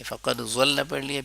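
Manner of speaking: ordinary talk, nothing marked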